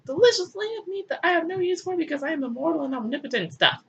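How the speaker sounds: high, gushing put-on God voice; hammed-up excited God; mock God delight. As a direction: savor the lamb like a delighted deity. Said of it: "he's like".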